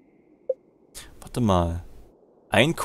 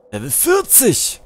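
higher, louder, slow amazed cry; surprised shout; 11 semitones higher, 6 dB louder than usual. Real, not acted. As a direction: call it out in disbelief. Surprised by the mine level.

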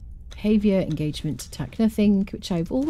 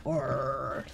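drawn-out, exaggerated American R, lower and softer; 4 semitones lower, 7 dB quieter than usual. Streamer mimicking how Americans say their R's, exaggerated American accent. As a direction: hold a long American "Orr" in imitation. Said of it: "'cause your R's are like".

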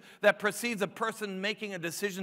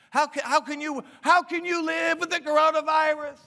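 panicked coworker; 7 semitones higher, 7 dB louder than usual. Retold or imitated. Retold panicked coworker asking.

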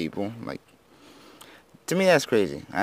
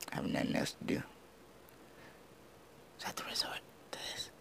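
whispered aside; 17 dB quieter, much faster than usual. Real, not acted. Self-deprecating aside.

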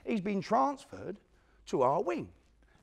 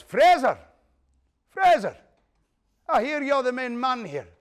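loud bellowing challenge across the room; a prison bruiser yelling for Frank; shouting; 8 semitones higher, 12 dB louder than usual. Retold or imitated. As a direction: call him out loud like a hard man. Big jock confronting Frank, shouting.

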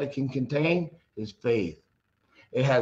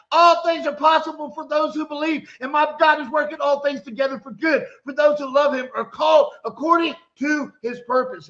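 loud, higher excited preaching; getting loud; 8 semitones higher, 4 dB louder than usual. Real, not acted. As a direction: preach it out full force, climbing higher. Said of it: "I'm getting loud".

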